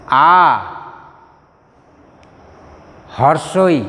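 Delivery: हर्षोई said hrosh-oi, with Bengali o sound; Bengali vowel names. Shown as a demonstration. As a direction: call out each Bengali vowel for students to repeat. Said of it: "अभी आप बोलने की कोशिश कीजिए".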